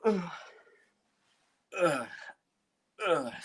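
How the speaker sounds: groaning through a stretch; strained noise mid-stretch. Real, not acted. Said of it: "Sorry, was just stretching".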